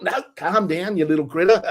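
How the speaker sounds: lightly voiced Australian presenter calming a critter; Australian nature guy. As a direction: soothe the mouse in a mild Australian accent. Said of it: "the Australian nature guy".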